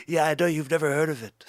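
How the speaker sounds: comic presidential impression, punchy and boastful; Trump. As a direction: put on a blustering politician voice. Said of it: "When you do a Trumper".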